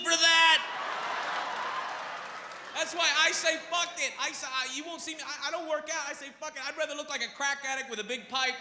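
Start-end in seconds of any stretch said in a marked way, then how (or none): none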